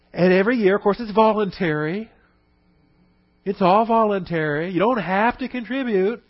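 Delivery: sarcastic. Acted. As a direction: sell the company line with sarcasm. Mocking the so-called voluntary donation.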